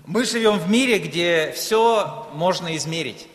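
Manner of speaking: usual speaking voice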